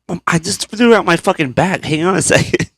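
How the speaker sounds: mildly pained retold protest; strained protest. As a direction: plead for a moment, acting hurt. Retold pained answer to partner.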